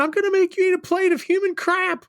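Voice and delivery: very high-pitched mock scream; imitated screaming. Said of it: "And he's screaming".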